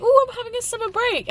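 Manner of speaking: mock carefree self. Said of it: "I've been like".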